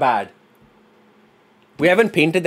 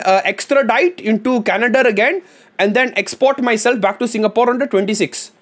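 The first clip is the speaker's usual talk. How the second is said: higher-pitched, exaggerated Indian accent; strong Indian accent; 7 semitones higher than usual. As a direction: lay the Indian accent on thick. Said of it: "I had the strongest Indian accent ever".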